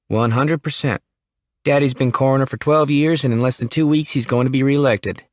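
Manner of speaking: hint of a shy Southern young man; young Jimmy. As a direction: play the jittery small-town kid. Jimmy speaking.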